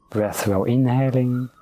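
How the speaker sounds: ordinary talk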